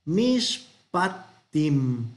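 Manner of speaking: mishpatim drawn out very slowly, syllable by syllable; slow teacher's model of mishpatim; Hebrew word model. Shown as a demonstration. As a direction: spell out mishpatim slowly for students. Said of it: "el plural va a ser".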